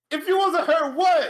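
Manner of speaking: high, loud mock taunt; imitating Jacob Fatu cutting him off; taunting challenge. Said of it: "Jacob says".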